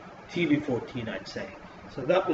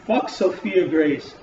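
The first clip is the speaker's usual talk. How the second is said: angry; 5 semitones higher, 6 dB louder, slower than usual. Real, not acted. Ranting about a segment.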